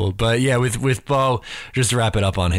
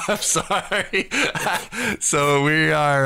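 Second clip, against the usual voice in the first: laughing; 5 semitones higher, much slower than usual. Real, not acted. Laughing mid-sentence at cohost.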